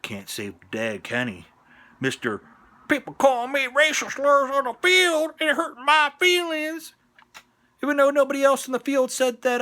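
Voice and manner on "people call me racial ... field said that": high, loud mock complaint; mocking put-on voice. Mocks player's complaint in put-on whine.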